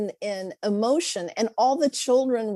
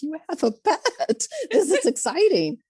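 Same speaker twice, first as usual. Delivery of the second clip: higher, laughing delighted teacher voice; mock excitement; 8 semitones higher than usual. Teacher reacting to a child's pet, laughing and delighted. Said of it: "I'm like".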